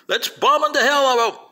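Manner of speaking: high-pitched rallying shout; mock belligerent cry. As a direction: parody a gung-ho war cry. Mimicking belligerent American rhetoric.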